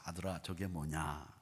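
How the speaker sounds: put-on slow, low, soft old man's question; lightly voiced elderly father, unhurried. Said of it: "연세가 많은 아버지가 약간 치매기가 있으셔서".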